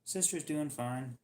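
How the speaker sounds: usual speaking voice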